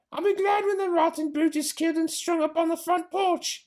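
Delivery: high, put-on voice of a big servant woman; Mabel calling out. Mabel calls upstairs to Missus Bean, high put-on voice. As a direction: call it up the cellar, pitched high. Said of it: "she called out".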